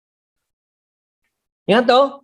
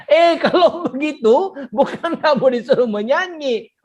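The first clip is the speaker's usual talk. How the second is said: high, amused scolding through laughter; giggly mock correction; laughing; 6 semitones higher than usual. Real, not acted. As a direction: laugh at your own silly demonstration. Laughing at own sing-song demo.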